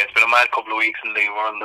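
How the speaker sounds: ordinary talk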